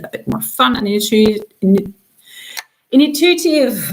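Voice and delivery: restarts "intu-, in-" before landing on "intuitive"; halting, false starts on "intuitive"; stumbling over a word. Real, not acted. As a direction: trip over "intuitive" twice, then get it out. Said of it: "I can't pronounce anything".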